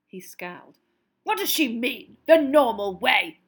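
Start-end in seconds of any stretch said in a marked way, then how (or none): 1.2-3.4 angry, loud and slow; spat out. A narrator's reading. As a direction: spit the words with disgust. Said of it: "he spat".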